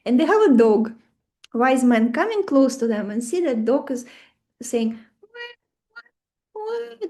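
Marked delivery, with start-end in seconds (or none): 5.2-7.1 dog whining. Retold or imitated.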